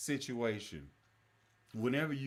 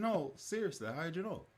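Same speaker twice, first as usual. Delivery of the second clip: put-on Wisconsin accent; 6 semitones higher than usual. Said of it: "And he was like".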